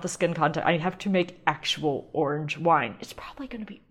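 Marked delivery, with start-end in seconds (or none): 3.0-3.8 whispered aside. Real, not acted. Doubting aside.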